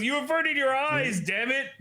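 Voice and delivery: very high hammed-up indignation; mock indignant outburst. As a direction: accuse him in a high put-on voice. Acted complaint at him looking away.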